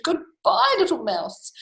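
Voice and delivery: high nervous farewell; lifted storybook goodbye; fleeing owl. Acted. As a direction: call a hasty goodbye in mock alarm.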